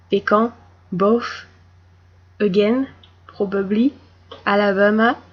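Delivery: word list in a strong French accent, each word rising; thick French accent, list intonation. Shown as a demonstration. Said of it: "And with the French accent".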